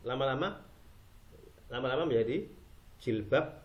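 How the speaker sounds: usual speaking voice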